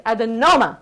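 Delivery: "Adenoma" with a big emphatic NO. Shown as a demonstration. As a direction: lean into the NO of "Adenoma". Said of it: "I wanna hear more of a no".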